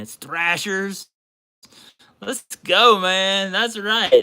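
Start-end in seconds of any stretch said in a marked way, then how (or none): 2.2-4.2 loud fan cheer; excited cheer. Real, not acted. Cheering a team jersey, loud fan cheer. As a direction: pump it up for the team.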